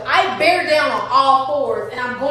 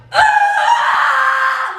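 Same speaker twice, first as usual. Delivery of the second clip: loud high scream of pain; 10 semitones higher, 6 dB louder than usual. Retold or imitated. Mother carried down stairs screams with labor urge. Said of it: "I was in that bitch screaming".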